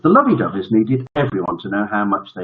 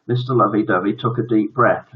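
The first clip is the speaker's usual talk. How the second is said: plain reading voice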